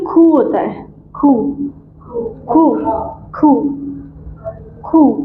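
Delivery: खू with an aspirated k, "khoo"; German letter Q. Shown as a demonstration. Teacher drilling Q for students, खू as aspirated "khoo". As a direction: puff air on the k of खू, long oo. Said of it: "vocal से निकलते हैं".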